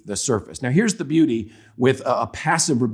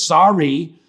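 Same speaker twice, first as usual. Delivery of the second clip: mock grudging kid's sorry, louder and higher; grudging apology; 5 semitones higher, 6 dB louder than usual. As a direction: spit out an insincere apology like a child. Retold child forced to apologize.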